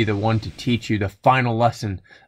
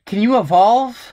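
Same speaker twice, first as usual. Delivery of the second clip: exasperated; 9 semitones higher than usual. Real, not acted. Yelling at Pokemon in frustration.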